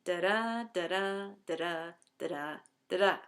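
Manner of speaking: da-DA, da-DA, each "da-da" tapped out with the second beat stressed; iambic rhythm demo. Shown as a demonstration. Teacher showing the class the sonnet rhythm, stress on each second "da". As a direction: chant "da-da" as a steady iambic beat. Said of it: "a stress pattern that goes like this".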